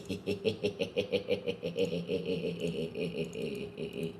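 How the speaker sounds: long, soft wheezy giggle; string out a soft run of giggles; wheezing laugh. Real, not acted. Host laughing at the bad news, long wheezy giggle.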